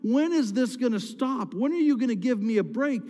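rising, pleading cry of frustration; exasperated plea to God. Retold or imitated. Pastor voicing David crying out to God, exasperated. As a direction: shake your fist at God and beg for a break. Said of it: "I'm shaking my fist at God, saying".

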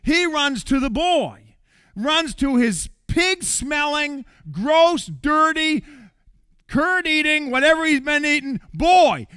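preacher builds to the father's embrace, loud and rising; building preacher's cry. Acted. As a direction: pile it on, climbing in volume.

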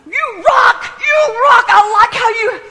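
high, loud shouting cheer; stadium cheering. Pretend. Pastor pictures herself on the field, shouting high cheers. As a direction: yell it from the sidelines. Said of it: "I'd be down there going".

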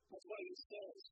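ordinary delivery

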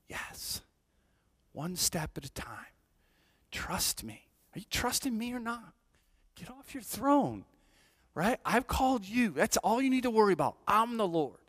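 a hint of God reassuring. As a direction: answer as God, quiet then firm. God answering in the retold prayer.

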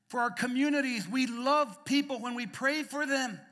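loud, high, impassioned preaching; preaching at full force. Acted. Preacher urging the congregation to pray, impassioned. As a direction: drive it home from the pulpit.

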